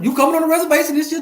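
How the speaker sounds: loud, high, fast hype shout; hype call-out. Real, not acted. Streamer shouting to his viewers about coming to the reservation, hyped. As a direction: fire up the crowd about the reservation.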